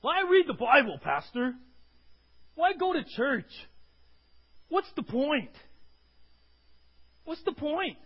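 high, loud mock complaint; whiny churchgoer. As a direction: imitate a fed-up churchgoer questioning the pastor. Congregant voiced complaining to pastor.

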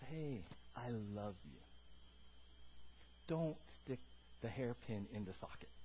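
soft, low parental warning; quiet, loving voice as God the Father; tender father. Acted. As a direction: gently caution a small child.

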